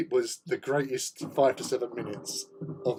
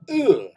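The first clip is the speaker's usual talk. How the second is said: loud, higher imitated "ugh"; friend's disgusted ugh; 7 semitones higher, 9 dB louder than usual. Retelling the noise that started it, mock disgust. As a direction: blurt out his disgusted noise. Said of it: "All he did was go".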